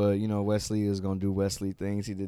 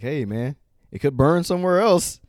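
joking shout; 7 semitones higher, 11 dB louder than usual. Retold or imitated. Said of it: "Damian Priest is like".

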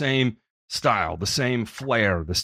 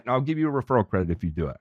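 usual speaking voice

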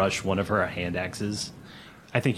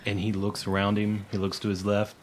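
ordinary delivery

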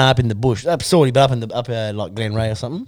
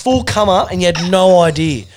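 normal talking voice

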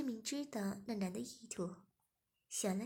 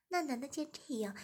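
ordinary delivery

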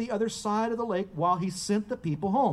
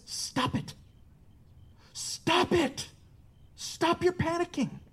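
restrained scolding, hushed first then pitched higher; acted rebuke; 5 semitones higher, slower than usual. Preacher voicing Jesus rebuking panicking disciples, acted rebuke. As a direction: rebuke them firmly without shouting, building in pitch. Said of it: "he rebukes them".

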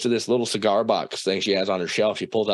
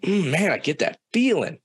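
higher-pitched retold unease; uneasy; 8 semitones higher than usual. Teen telling the aunt somebody is watching, uneasy. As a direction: voice lifts, sensing a watcher.

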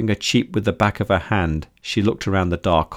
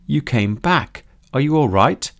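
joyful; 6 semitones higher than usual. A narrator's reading. Joyful reunion with Millie.

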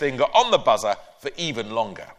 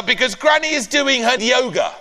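high, loud desperate excuse; blurted excuse; 11 semitones higher, 5 dB louder than usual. Acted. Ben inventing an excuse for the neighbour, high and loud.

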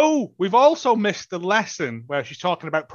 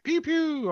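mock zap noise; 11 semitones higher, 3 dB quieter than usual. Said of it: "he's like".

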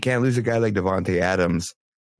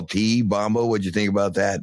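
usual speaking voice

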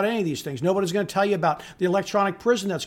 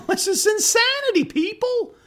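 laughing disbelief; 14 semitones higher than usual. Real, not acted. Laughing disbelief at truck prices.

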